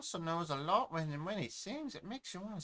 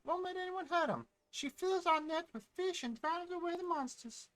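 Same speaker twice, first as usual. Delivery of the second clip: put-on much higher, bright village girl; sister's put-on voice; 12 semitones higher than usual. Little sister praising her mother, high voice. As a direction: pitch way up to play the little sister. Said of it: "I don't know why I'm doing, like, two different accents".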